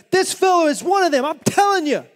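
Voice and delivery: high, loud insisting cry; insistent shout. Acted. Servant girl telling the bystanders, loud insistent. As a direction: point him out to the crowd loudly.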